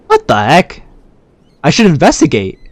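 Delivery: ordinary talk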